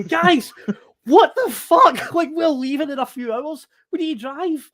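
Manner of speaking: a panicked tantrum with a laugh cracking through. Retold or imitated. To drunk mates stumbling in at dawn, high frantic tantrum. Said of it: "having nearly a tantrum".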